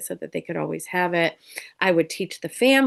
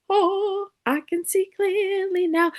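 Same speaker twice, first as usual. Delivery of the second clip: much higher, lifted voice of relief; relieved griever; 14 semitones higher, slower than usual. Retold or imitated. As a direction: voice a client suddenly seeing light.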